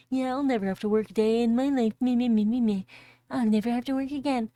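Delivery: fast, loud mocking whine of a smug freeloader; mocking gloat. Streamer mocks the greedy father, loud fast mockery. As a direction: sneer it like a greedy kid. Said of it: "He's like".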